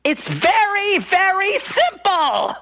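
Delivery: very high, slow villain sneer; Caesar Clown voice. Pretend. Asked as Caesar Clown about experimenting, high and gleeful. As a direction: answer like a gleeful mad scientist. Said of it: "Caesar, how hard is it".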